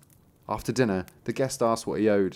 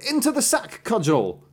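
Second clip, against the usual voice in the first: very high-pitched magic command; calling a command; 12 semitones higher, 3 dB louder than usual. A narrator's reading. Master teaching how to recall the cudgel, calling out. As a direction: sing out the order high, then drop on the last word.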